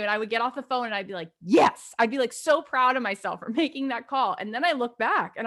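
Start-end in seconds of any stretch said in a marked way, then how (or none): 1.4-1.9 triumphant yes. Retold or imitated. Triumph after hanging up.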